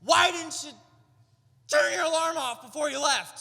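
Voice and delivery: high, loud, fast outraged ranting; comic outrage at an alarm clock; exasperated rant. Retold or imitated. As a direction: vent like you were jolted awake at four a.m. Woken at 4am, ranting at sister.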